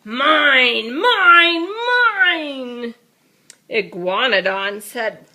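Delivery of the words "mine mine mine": loud grabby roar, much higher on the middle one; roaring mine. Acted. Greedy dinosaur roaring at everyone, loud. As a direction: roar it at the whole schoolyard. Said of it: "he roared to one and all".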